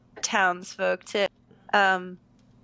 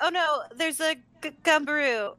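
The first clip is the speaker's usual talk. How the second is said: mock alarm; 7 semitones higher than usual. Faking alarm to escape.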